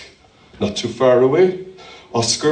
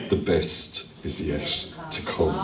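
hushed promise; 11 semitones lower, 9 dB quieter than usual. Real, not acted.

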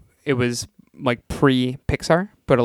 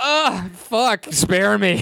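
mock groan; 7 semitones higher, much slower than usual. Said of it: "You're gonna".

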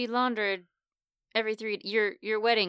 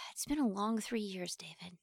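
tired sighing whisper; weary whisper; 2 semitones lower, 9 dB quieter than usual. Real, not acted. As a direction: breathe it out wearily.

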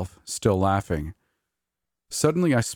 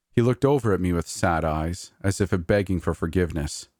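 usual speaking voice

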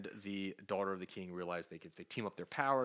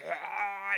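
a high, loud mock battle cry; straining yell; 11 semitones higher, 6 dB louder than usual. Namor holding the king up in the air, a loud mock yell. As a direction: let out a short heroic roar.

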